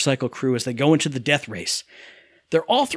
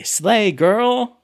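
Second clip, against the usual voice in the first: higher-pitched mocking slang; kids' slang; 5 semitones higher than usual. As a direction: mimic kids' lingo, pitched up. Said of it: "that's what the kids say".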